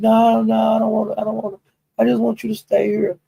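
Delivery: high begging voice; pleading friend. Retold or imitated. S D begs Broadway to stay on the live, high. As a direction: plead high not to be left. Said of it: "And they said".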